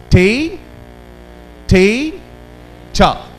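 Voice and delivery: "te, te, cha" counted out as separate loud beats; syllable drill. Shown as a demonstration. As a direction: chant "te, te, cha" beat by beat. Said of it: "Speak with me".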